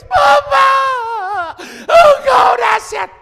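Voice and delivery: mock wailing prayer, very high and loud; tangisan jemaat. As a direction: wail it out like a congregation crying to God. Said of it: "mereka nangis". (meratap keras meniru jemaat yang menangis)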